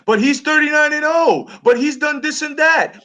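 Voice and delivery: high-pitched, insistent argumentative yell; mock yelling. Debater yelling facts back over threats, mock yelling. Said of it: "and I'm yelling facts".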